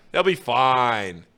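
drawn-out slow repetition; slow self-soothing. Retold or imitated. Fan talking himself down about the Reds, slow self-soothing. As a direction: stretch each word to calm yourself. Mocking his own false confidence.

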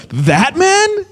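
high-pitched, incredulous guard; shocked disbelief. Retold or imitated. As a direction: imitate someone stunned at who was pointed out. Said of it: "his face was like".

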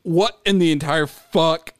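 normal talking voice

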